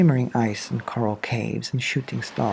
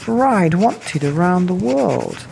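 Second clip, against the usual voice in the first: higher, slow and louder announcing; silvery trail message; 7 semitones higher, 4 dB louder, slower than usual. Acted. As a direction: spell out the trail's message, unhurried. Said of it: "looped and curled and said".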